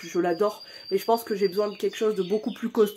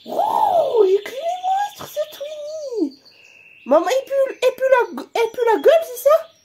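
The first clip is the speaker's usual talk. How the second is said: very high, louder squeaky pet voice; pet's voice; 7 dB louder than usual. Pretend. Pet's voice complaining about mum.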